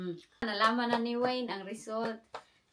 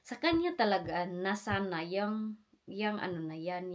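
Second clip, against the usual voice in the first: ordinary talk, nothing marked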